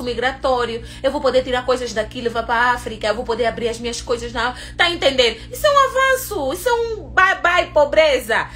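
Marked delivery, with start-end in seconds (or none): 5.5-8.5 much higher, emphatic declaration; excited high proclamation; excited emphasis. Real, not acted. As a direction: lift it high, celebrating. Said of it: "repeats with emphasis".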